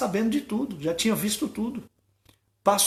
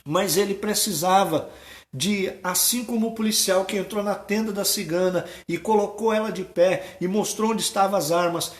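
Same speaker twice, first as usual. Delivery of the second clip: ordinary delivery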